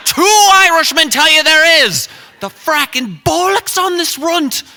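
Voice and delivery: high, shouted Irish outrage; hammed-up furious Irishman; Irish accent. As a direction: explode into a full-volume put-on Irish rant. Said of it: "this accent".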